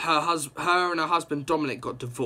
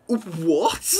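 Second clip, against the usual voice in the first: loud, disbelieving what; stunned outcry; shocked shout; 3 semitones higher, 4 dB louder than usual. Real, not acted. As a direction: blurt it out in real shock. Shocked by comic reveal.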